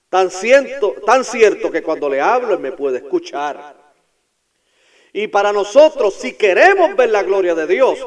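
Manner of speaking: loud, high fervent preaching; preaching at full force. Real, not acted. Pastor to his congregation, fervent preaching. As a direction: build the sermon to its peak.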